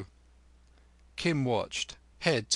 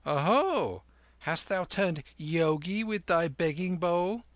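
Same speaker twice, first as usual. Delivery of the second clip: higher, teasing market woman's cry; mocking shopwoman; 7 semitones higher than usual. A narrator's reading. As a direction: tease the boy like an old vendor. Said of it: "she cried".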